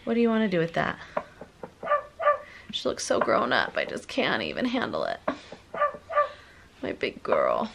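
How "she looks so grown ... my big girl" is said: much softer and lower, almost to herself; tender and wistful. Real, not acted. Mom admiring her toddler, soft and tender.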